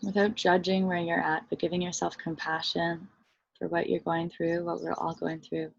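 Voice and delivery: soft, unhurried meditation voice with long pauses; guided meditation. Real, not acted. Teacher leading listeners into body and breath, guided meditation. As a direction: guide them gently inward, softly and evenly.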